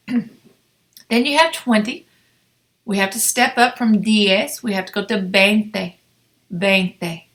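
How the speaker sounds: usual speaking voice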